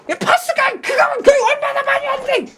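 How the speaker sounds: high, loud shrieking shout with a laugh; furious wife shout. Retold or imitated. Wife shouting at husband over the bill, high laughing shout.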